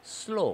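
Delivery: "স্লো" with a hissed opening s; high, falling "স্লো", the s drawn out; initial s demo. Shown as a demonstration. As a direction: lean on the hiss that starts "স্লো". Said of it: "আমরা স্লো বলতে প্রথমে এস একটা শব্দ আসছে".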